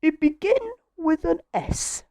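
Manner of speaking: high-pitched storybook character voice; scatty, high character read; Miss Scatterbrain. A narrator's reading. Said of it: "said Miss Scatterbrain".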